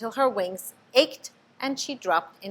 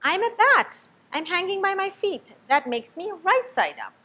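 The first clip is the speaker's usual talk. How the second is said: higher and louder; insistent little bat; 11 semitones higher, 4 dB louder than usual. A narrator's reading. Stellaluna insists to the bat, high and firm. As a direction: stand your ground.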